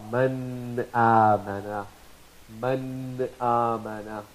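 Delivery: slow Man amana, the n of Man cleanly sounded before the hamza; clear izhar. Shown as a demonstration. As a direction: say Man amana slowly, n fully clear, no merging. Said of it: "with izhar".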